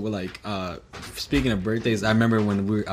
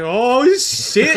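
alarmed oh shit; 12 semitones higher, 8 dB louder than usual. Retold or imitated.

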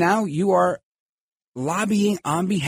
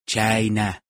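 drawn-out mock presidential "China"; slow, exaggerated impression on "China"; Trump; 6 semitones lower than usual. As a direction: stretch "China" out slowly as an impression. Repeats his Trump-style China.

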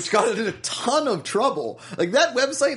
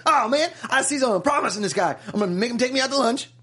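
a touch higher, put-on hustler voice; lightly acted Joshua; 7 semitones higher, faster than usual. Joshua plotting a free lunch, acted voice. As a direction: voice Joshua's eager scheming, lightly. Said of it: "Josh was like".

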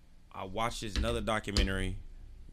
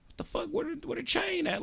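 mock disbelief; 3 dB quieter than usual. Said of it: "You like".

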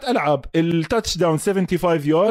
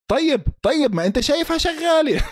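exasperated; 10 semitones higher than usual. Real, not acted. Exasperated high pitch.

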